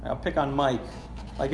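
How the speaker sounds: normal talking voice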